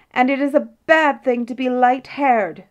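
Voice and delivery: high mock scream; shrieked retort, kept in check. A narrator's reading. Queen calling after the king for last words, mock scream. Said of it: "screamed she".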